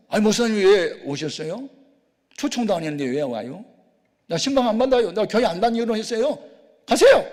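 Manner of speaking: high, loud annoyed church member; irritated refusal. Retold or imitated. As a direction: turn the pastor away with rising annoyance. Acted visit refused at the intercom. (짜증 섞인 높고 큰 목소리로 거절)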